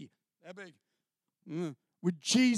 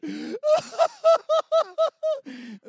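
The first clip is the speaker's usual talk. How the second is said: high laughing sob; 10 dB louder than usual. Retold or imitated. Overwhelmed at the skit.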